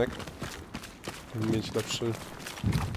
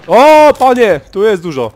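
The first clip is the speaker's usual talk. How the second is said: excited shout; 8 semitones higher, 15 dB louder than usual. Real, not acted. Sudden enemies spotted in game.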